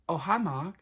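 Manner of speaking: hammed-up movie quote impression; mock Tommy Wiseau line. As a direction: belt out the famous greeting in a put-on voice. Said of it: "one of these two lines".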